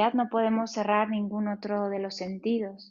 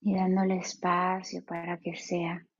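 guided meditation. Acted. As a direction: ease them into stillness, unhurried.

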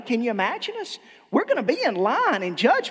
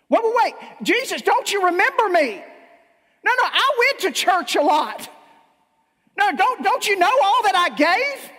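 frantic, higher and louder plea; desperate begging; 9 semitones higher, 5 dB louder than usual. Acted. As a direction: beg Jesus not to turn you away, panicking. Rejected sinner begging Jesus.

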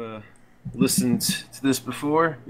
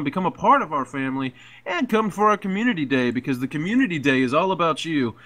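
ad read; 4 semitones higher than usual. Acted. Resumes the guild advert.

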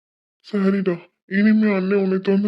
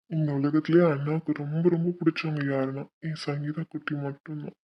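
tender reassurance; 6 semitones lower, 4 dB quieter than usual. Acted. Brother tenderly reassures sister.

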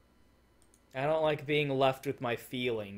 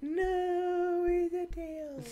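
very high, held, stretched-out delivery; drawn-out high cry; much slower than usual. Real, not acted. Player reacting to the show, drawn-out high cry. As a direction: draw it out high on one held note.

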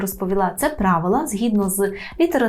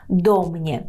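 "До mnie" as one word, ДО stressed, falling; stress on first syllable; 3 semitones lower than usual. Shown as a demonstration. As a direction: hit ДО, drop "mnie". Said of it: "передостанній склад".

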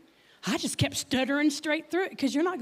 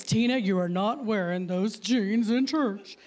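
mock-scolding husband voice; disapproving husband; 3 semitones lower than usual. Husband objecting to her jeans in church, mock stern. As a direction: imitate a stern husband, dropping the voice. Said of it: "We had a fight over this".

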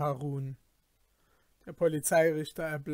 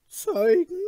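high, startled question; turning pale; 14 semitones higher, 8 dB louder than usual. Acted. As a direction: blurt it out suddenly frightened. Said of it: "fragte dieser erbleichend".

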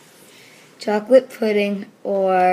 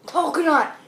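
high, loud happy cry; excited shout; 7 semitones higher, 5 dB louder than usual. Real, not acted. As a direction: cheer at the good flavor. Relieved to get a good bean.

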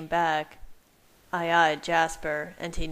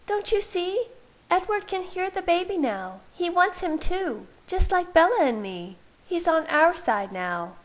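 high-pitched woman, urgently persuading; insistent Rosalie; 13 semitones higher than usual. Acted. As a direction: plead the case in a high female voice. Voices Rosalie, urging the others.

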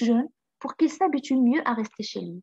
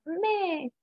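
a long mournful goat's bleat, pitched up and soft; sad bleat; 6 semitones higher, 4 dB quieter than usual. Acted. Goat staring at the mountain, sad soft bleat. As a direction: bleat it softly and sadly like a goat pining.

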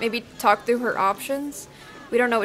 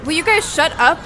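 snapping loud, higher voice; angry shout; 6 semitones higher, 8 dB louder than usual. Acted. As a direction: yell at them to stop. Friends arguing over her.

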